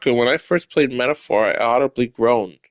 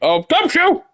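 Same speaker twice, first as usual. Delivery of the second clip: frantic falsetto alarm; very high, urgent character cry; high-pitched yelling. Acted. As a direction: yell the alarm in a squeaky voice. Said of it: "There shall be no yelling".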